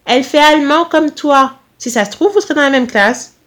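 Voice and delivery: high-pitched, firm instructions; authoritative mother. A narrator's reading. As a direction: lay down instructions to your son. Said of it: "elle lança avec autorité".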